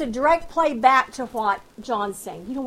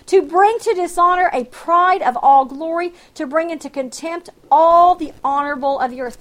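forceful scripture reading; 2 semitones higher, 6 dB louder than usual. Acted. Preacher proclaiming Isaiah's oracle on Egypt to the congregation, forceful reading. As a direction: proclaim the verse, a touch louder.

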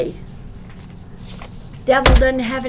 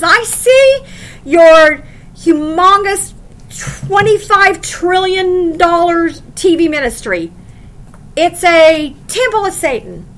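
booming rebuke; 5 semitones higher, 6 dB louder, slower than usual. Acted. Preacher voicing God's rebuke.

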